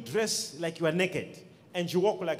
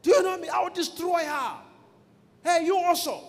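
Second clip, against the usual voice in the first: high-pitched, scolding demon; shouting demon; 8 semitones higher than usual. Retold or imitated. Demon scolding the girl it possesses, high imitated shout. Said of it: "A demon began to shout at her".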